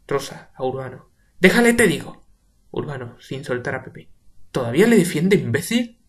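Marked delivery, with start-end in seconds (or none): 1.4-2.3 much higher, louder command; shouted order; sharp shout. Acted. Rosa shouting at Urbano. 4.5-6.0 higher, louder insult; shouted insult; angry shout. Acted. Urbano insulting Rosa.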